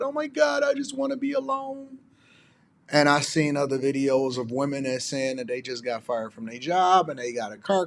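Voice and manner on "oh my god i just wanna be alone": much higher, exaggerated dramatic mock voice; mocking unhappy wife. Mimicking women who want to leave good marriages, high mocking voice. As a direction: parody a wife sighing to leave. Mocking wives in review videos.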